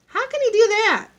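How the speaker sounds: very high, loud blurted question; child's high outburst; indignant pupil. A narrator's reading. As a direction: call it out from the back of the class. Pupil blurts from back of room.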